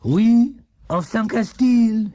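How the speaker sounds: put-on high, slow French-accented oration; Dupont's proud speech. A narrator's reading. Monsieur Dupont addressing the village crowd, proud and grand. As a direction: proclaim it to the crowd. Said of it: "he cried".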